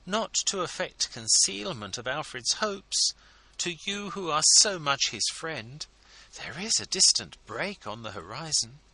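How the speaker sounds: high, confiding, intriguing tone; wife confiding her husband's hopes, intriguingly; very interesting manner. A narrator's reading. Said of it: "in a very interesting manner".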